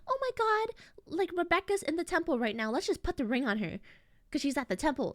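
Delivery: lightly voiced ditzy girl. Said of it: "And Tiffany's like".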